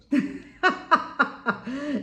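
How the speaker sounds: high-pitched burst of laughter; hearty laugh. Real, not acted. Vlogger amused by her own explanation, hearty laugh.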